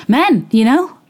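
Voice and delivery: high, knowing sarcastic jab. Real, not acted. Host ribbing about men, sarcastic. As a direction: roll your eyes at men.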